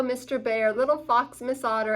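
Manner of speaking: plain reading voice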